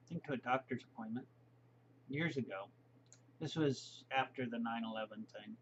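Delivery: normal talking voice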